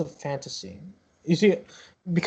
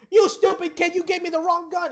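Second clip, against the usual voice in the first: very high, strained comic yell; angry yelling. Acted. Ticket seller berates a kid over wrong gun, yelling. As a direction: shriek it at the kid, acted outrage. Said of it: "yelling again".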